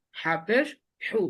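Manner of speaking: ordinary talk, nothing marked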